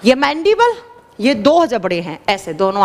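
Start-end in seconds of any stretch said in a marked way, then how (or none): 0.1-0.7 mandible said high and loud with a rise; teacher's drill, mandible high and rising; recitation stress. Shown as a demonstration. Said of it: "आप साथ में नाम याद कर लो".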